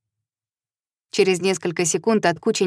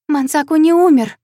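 much higher and louder, protesting; thin, high voice; 9 semitones higher, 6 dB louder than usual. A narrator's reading. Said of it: "её голос звучит тоньше обычного".

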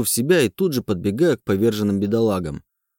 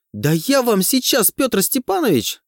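furious shriek; 12 semitones higher, slower than usual. A narrator's reading. Dyatlova furious at teacher.

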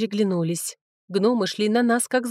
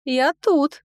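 put-on high, louder girlish call; ringing girlish voice; 7 semitones higher, 5 dB louder than usual. A narrator's reading. Girl announcing herself to the dragon lord, ringing and girlish. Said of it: "раздался звонкий девичий голос".

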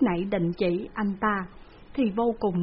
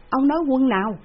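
higher and louder, pressing the question; insistent repeat; 5 semitones higher, 7 dB louder than usual. A narrator's reading. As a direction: demand it again, louder. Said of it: "lão bá hộ phải hỏi lại". (hỏi dồn, cao và to hơn)

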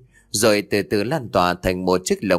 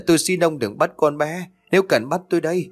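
high, tearful begging; mother begging the ghost for her child, tearful; crying plea; 6 semitones higher than usual. Retold or imitated. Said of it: "vừa chạy vừa khóc lóc xin".

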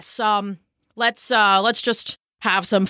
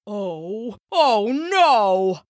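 bullfrog's rising dismay; dismayed cry; much slower than usual. A narrator's reading. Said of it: "cried the bullfrog".

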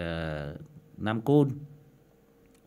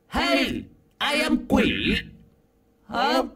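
much higher, louder demon cry; 14 semitones higher, 4 dB louder, slower than usual. Shown as a demonstration. Host demos the demon voice on his new audio setup. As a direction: shriek it like a demon. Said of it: "con quỷ rồi".

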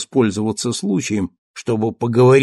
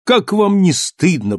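higher, insistent reproach; reproachful repeat; 7 semitones higher than usual. Acted. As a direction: press the shame home again. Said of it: "повторил он".

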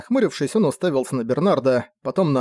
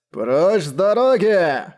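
slow, higher-pitched bellow; booming shout; 5 semitones higher, 3 dB louder, much slower than usual. A narrator's reading. Newcomer ordering the crowd out of the road, booming. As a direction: boom it out, drawing every word long. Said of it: "прогрохотал со стороны дороги новый язычный голос".

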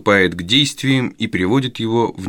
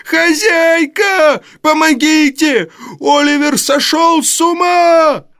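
very high, louder, slow crying scream; terrified wail; 4 dB louder, slower than usual. A narrator's reading. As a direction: howl for your life. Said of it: "завопил Ной". (истошный вопль от страха)

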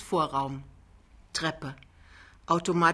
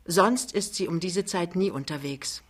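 ordinary delivery

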